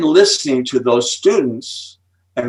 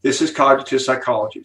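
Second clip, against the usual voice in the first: ordinary talk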